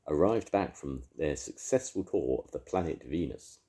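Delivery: plain reading voice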